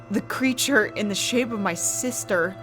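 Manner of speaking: ordinary delivery, nothing marked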